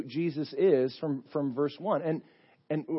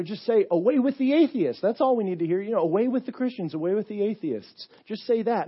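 high, louder urging; urgent coaxing; 7 semitones higher, 5 dB louder than usual. Retold or imitated. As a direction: push him to just say it. Sheriff urging him to chant.

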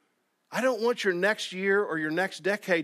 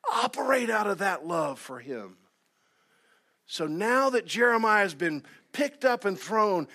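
higher-pitched, emphatic preacher voice; fired-up preaching; 4 semitones higher than usual. Real, not acted. Preacher urging the church to get ready, fired-up preaching. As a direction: drive it home like a preacher. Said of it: "now I'm gonna start preaching".

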